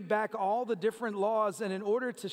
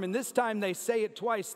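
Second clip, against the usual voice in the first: ordinary talk, nothing marked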